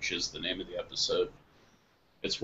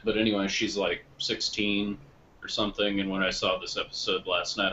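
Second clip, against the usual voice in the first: usual speaking voice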